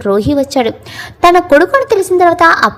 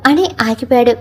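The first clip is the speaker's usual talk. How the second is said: usual speaking voice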